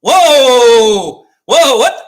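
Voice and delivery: loud startled shout, pitch leaping up; shocked whoa. Real, not acted. Streamer reacting to a sudden play: loud startled whoa. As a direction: caught completely off guard.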